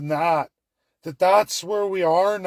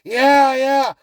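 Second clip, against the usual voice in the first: high, loud sarcastic cheer; hammed-up celebration; mock cheering; 6 semitones higher, 7 dB louder than usual. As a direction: cheer as if thrilled, laying on the sarcasm. Sarcastic cheering of oil deal.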